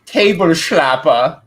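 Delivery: loud, drawn-out mock German; slow, loud German-accented announcement. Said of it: "I just turned German".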